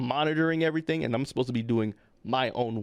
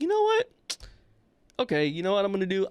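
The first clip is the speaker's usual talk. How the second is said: higher-pitched, quick "you know what" of someone done with this; fed-up exclamation; 7 semitones higher, faster than usual. Retold or imitated. Receptionist losing patience with the visitor, retold fed-up exclamation. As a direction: snap into decision mode, exasperated.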